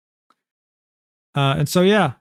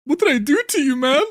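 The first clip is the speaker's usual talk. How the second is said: shocked outsider; 10 semitones higher than usual. Retold or imitated. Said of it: "It's like".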